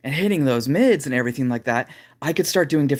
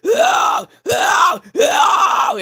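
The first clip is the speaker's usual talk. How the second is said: very high, loud shouted oh screams; 6 dB louder, much slower than usual. Shown as a demonstration. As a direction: reach for the heights with a scream. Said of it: "and I could start hitting those".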